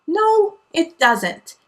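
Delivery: slow, loud, high no; slow loud contradiction; firm rebuttal. Real, not acted. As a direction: shut the claim down slowly. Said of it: "rebuttal to Capricorn".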